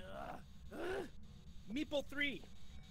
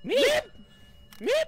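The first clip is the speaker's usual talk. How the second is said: startled meep; 12 dB louder than usual. Pretend. Sudden high meep.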